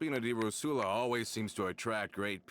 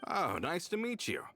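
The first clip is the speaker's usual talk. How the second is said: bright greeting; 9 semitones higher than usual. Acted. Greeting new partners brightly.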